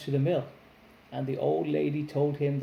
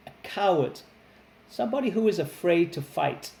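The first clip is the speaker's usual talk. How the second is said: usual speaking voice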